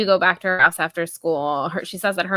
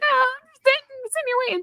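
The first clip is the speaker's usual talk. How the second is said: much higher, squeaky put-on voice for the caught girl; hammed-up awkward girl; faster than usual. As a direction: play her caught out, squeaking high. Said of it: "Something about that line was, like, so comedic gold".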